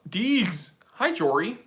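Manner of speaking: ordinary delivery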